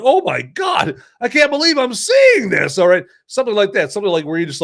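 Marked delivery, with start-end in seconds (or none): 0.0-2.8 amazed onlooker. Retold or imitated. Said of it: "Something that you're like".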